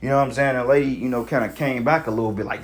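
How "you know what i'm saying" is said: ordinary talk, nothing marked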